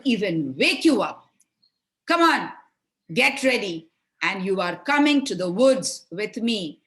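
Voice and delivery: loud, high scolding wake-up call; angry mother. Acted. Stepmother rousing the lazy children at dawn, angry. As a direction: bark them out of bed. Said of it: "angry voice of their mother".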